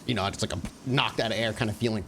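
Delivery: usual speaking voice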